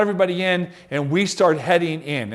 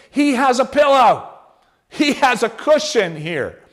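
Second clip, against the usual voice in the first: amazed exclamation; 6 semitones higher than usual. Acted. Marvelling Jesus sleeps on a cushion.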